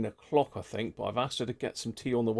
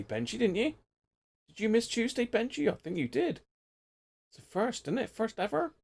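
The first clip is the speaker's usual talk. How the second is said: much higher, singsong ribbing of a regular; high, mock-scolding lilt; playful teasing; 12 semitones higher than usual. Real, not acted. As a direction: tease him like a mate who finally slipped up. Teasing a viewer who missed Tuesday.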